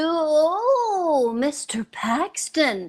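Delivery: whispered, slow and admiring; hushed swooning greeting; breathy swoon. Acted. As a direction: melt at the sight of him. Wife swooning over handsome guest.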